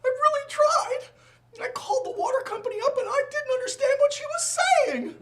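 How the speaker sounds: very high, loud, hammed-up whine; mock-wailing wife. Wife excusing herself to husband over water, mock wailing. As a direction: imitate an upset wife pleading in squeaky falsetto. Voices wife's distressed excuse.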